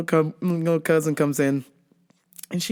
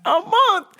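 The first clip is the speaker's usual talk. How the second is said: shocked disbelief. Retold or imitated. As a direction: shriek it in shock. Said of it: "were like".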